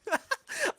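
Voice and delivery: squealing high laugh; real laugh; high giggle. Real, not acted. Burst of laughter.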